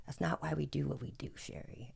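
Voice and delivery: softly delivered imitation of a friend; quiet retold wisdom. Friend lifting his hat to correct her, quiet imitation. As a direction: drop soft, trailing to near silence on the name.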